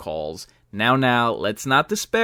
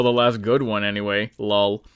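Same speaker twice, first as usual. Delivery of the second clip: ordinary talk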